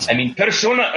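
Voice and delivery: attempted Japanese accent, pitched high; a Japanese accent that slips toward Scottish. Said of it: "I was trying to do a Japanese accent".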